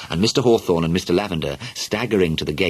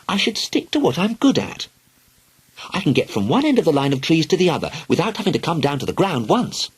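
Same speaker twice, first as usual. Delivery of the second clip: put-on high, proud young girl; little girl voice; 10 semitones higher than usual. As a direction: boast like a little tree climber. Helen voiced as a child.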